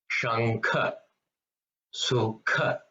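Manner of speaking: "Shankha" and "Sukha" with a puff of air on kh; Mahaprana. Shown as a demonstration. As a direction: push breath out on the kh of "Shankha", "Sukha". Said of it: "give more emphasis on the consonant".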